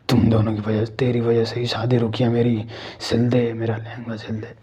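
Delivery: low, menacing spirit voice fading to a whisper; terrifying ghost voice. Acted. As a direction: speak it low like a vengeful dead bride, ending in a whisper. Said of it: "बोल दिया बड़ी भयानक आवाज़ में".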